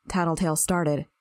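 ordinary delivery, nothing marked

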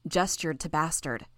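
ordinary delivery, nothing marked

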